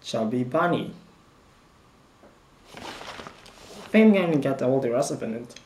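talking through a mouthful of chocolate; words mushed by a full mouth. Real, not acted. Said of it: "Chocolate is melting".

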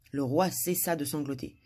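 ordinary delivery

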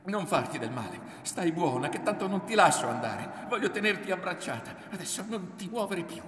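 high, loud urgent cries; pleading shout. A narrator's reading. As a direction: shout to restrain and hold her.

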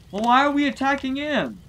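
high confused henchman. Acted.

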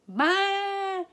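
high, loud bleating maa; sheep bleat. A narrator's reading. Sheep plotting to help the duck, high loud bleat. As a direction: bleat it like a lamb. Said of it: "said the sheep".

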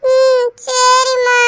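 very slow, high feverish whimper; a feverish boy answering his mother; sick whimper. Acted. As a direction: whimper weakly, burning with fever.

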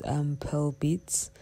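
usual speaking voice

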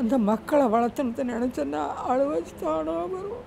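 slow, crying voice that fades at the end; real tearful lament. Real, not acted. Mother recalling the hardship of raising children, crying. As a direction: drag the words out through tears. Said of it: "அழுவாச்சு வராத இருக்காது".

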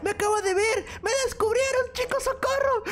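high, frantic yelling; panicked. Acted. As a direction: flee in mock panic. Caught, fleeing in panic. (pánico fingido)

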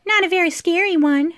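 lightly voiced, high teasing reply; teasing girl. A narrator's reading. Trina is unimpressed by the giant, teasing.

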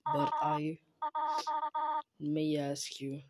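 grieving mother. Acted. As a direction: speak as a mourning mother, hushed and heavy. Voicing the grieving mother character.

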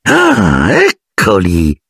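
understated clenched annoyance; through clenched teeth. A narrator's reading. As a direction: announce them with strained displeasure. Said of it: "a denti stretti".

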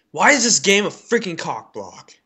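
ordinary talk, nothing marked

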